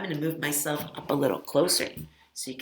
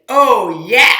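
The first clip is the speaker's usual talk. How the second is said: loud, higher whooping oh yeah; 5 semitones higher, 17 dB louder than usual. Shown as a demonstration. Teacher models the chant's whoop for kids, loud. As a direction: belt out the oh yeah. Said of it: "Then say".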